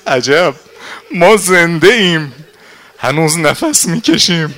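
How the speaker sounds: high, loud, anguished preaching cry; agitated outcry. Acted. Nafe hearing Zeinab's worry, crying out loud. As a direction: cry out in alarm at full voice.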